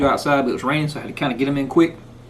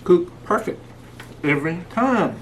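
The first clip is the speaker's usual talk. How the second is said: mouth full; 2 semitones higher, slower than usual. Real, not acted. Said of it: "Sorry, I'm talking with food in my mouth".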